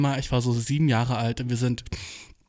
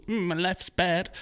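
slow, whiny mock moan about life; slow mock-pitiful English whine; mock self-pity; 4 semitones higher, slower than usual. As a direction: ham up the sad sack, slowly. Mock self-pitying sulk.

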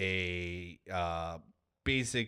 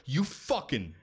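excited; 7 semitones higher than usual. Real, not acted. Building up the squirrel kick.

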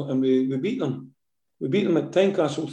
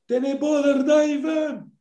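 high, slow, stretched shout across the pitch; mock shout at the goalie; 12 semitones higher, much slower than usual. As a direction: yell it out long and high to the keeper. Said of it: "I shouted".

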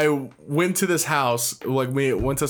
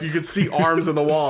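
usual speaking voice

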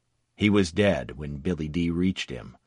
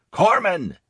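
high desperate shout; crying out; 12 semitones higher, 5 dB louder than usual. Acted. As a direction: call for the medic, loud. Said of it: "crying out".

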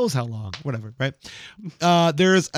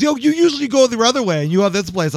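mock neighbor; 7 semitones higher, 4 dB louder than usual. Neighbor tracking him, retold.

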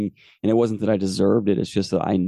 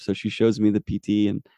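ordinary talk

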